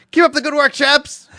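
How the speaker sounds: high, loud mock-British cheer; hearty loud sign-off. Co-host ending a British listener's letter, loud and hearty. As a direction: call it out heartily.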